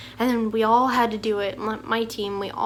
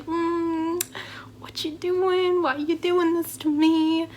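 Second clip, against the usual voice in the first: higher, drawn-out plaintive complaint; mock reproach; 5 semitones higher, slower than usual. Student side-eyeing the classmate beside them, high mock-plaintive reproach. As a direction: whine it slowly with side eye.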